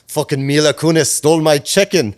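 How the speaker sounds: loud, high mock outrage in a Russian accent; bad Russian-Ukrainian accent. Publicist voices client's chicken grievance, mock Russian accent. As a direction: act out her outrage with a put-on Russian accent. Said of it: "this is gonna be the worst accent".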